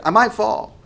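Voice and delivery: high, loud imitation of a frightened kid; imitated child crying out. Scared son stuck on monkey bars to dad, imitated crying out. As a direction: wail it like a panicky little boy. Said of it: "he starts crying out to me".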